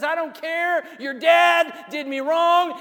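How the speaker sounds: high, loud defiant shout; furious runaway husband. Acted. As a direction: spit it back at Laban. Jacob defiant, fleeing with Rachel.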